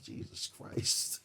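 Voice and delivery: breathless whispered gasp of disbelief; hushed, stunned mutter; awed whisper. Retold or imitated. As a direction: whisper it, stunned by how much she eats. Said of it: "I'm just watching her like".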